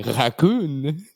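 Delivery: low French-style pronunciation; mock French accent. Shown as a demonstration. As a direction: say it fancy, all French-like. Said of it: "when you say it all French-like".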